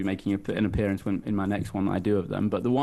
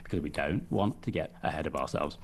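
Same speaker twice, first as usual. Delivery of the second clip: Nigel Farage; 2 semitones lower, 4 dB quieter than usual. Acted. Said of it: "I know little buzzwords that he says".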